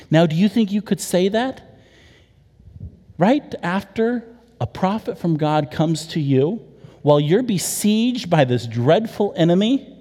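ordinary talk, nothing marked

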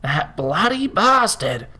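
a slow furious growl at the betrayal. A narrator's reading. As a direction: spit it out slowly as a growl. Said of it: "Harold growled".

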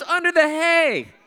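high, slow mock wail; wailing boy. Boy telling neighbor where his father is, mock wailing. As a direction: draw it out as a distraught child's punchline. Boy's wailed punchline about buried father.

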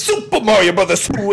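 loud, hammed-up YouTuber announcement; terrible Video Game Donkey. Podcaster mimicking a YouTuber's number one pick, terrible hammed-up impression. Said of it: "I'm gonna do a terrible Donkey impression".

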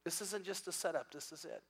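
very soft, hushed inner voice; whisper in the mind. Acted. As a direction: say it hushed, like a thought just dawning. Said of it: "have a whisper in your mind".

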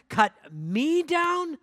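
the second, wailing cut me down; horrified cry. Acted. Bamboo crying out at the master, high slow horrified wail. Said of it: "A trembling of great horror shook bamboo".

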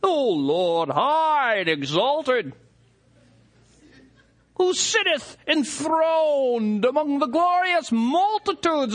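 showy churchman praying to be overheard; mock Elizabethan prayer. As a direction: ham up a lofty King James prayer. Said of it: "the guy lapses into Elizabethan English".

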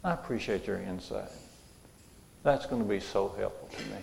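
soft, calm and gracious; calm reply. Pretend. Husband Ken answering his scolding wife, calm and soft. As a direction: imitate a gracious thank-you, quietly. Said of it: "Ken just turned around and said".

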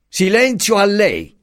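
high, slow, booming command; thundering. A narrator's reading. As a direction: thunder it down like a fist on the desk. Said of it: "tuonò l'aggiunto".

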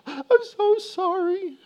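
put-on very high, drawn-out child's wail; imitated wailing. Son answering dad's question, wailing. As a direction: wail it slowly like a distraught child.

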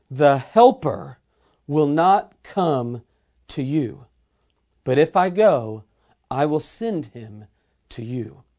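scripture reading. Real, not acted. Preacher reading Jesus' words to listeners, solemn scripture reading. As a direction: read it out phrase by phrase, deliberately.

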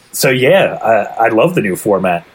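normal talking voice

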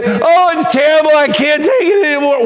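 hammed-up self-pity; whining and crying. Congregation imagined whining in their room, a mock crying whine. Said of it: "whine and cry".

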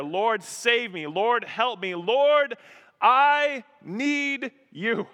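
impassioned, drawn-out appeal for help; desperate prayer. Acted. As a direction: cry out to God, stretching the last words. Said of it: "It's a prayer of desperation".

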